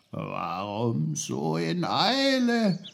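slow, drawn-out grumbling tree, swooping up at the end; growling oak. A narrator's reading. Oak questions boy who tripped on root, growling. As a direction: grumble it slowly like an old tree. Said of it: "knurrte die Eiche".

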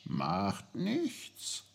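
soft, airy tree voice; talking birch. Acted. Birch reassures the sorry boy, airy voice. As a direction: brush it off softly, lilting upward.

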